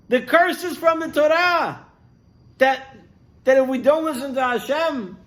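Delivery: high, loud, aghast at what she did; shocked and scared, pleading for her to see; horrified husband. Acted. Husband confronts wife over the curses page, horrified and high.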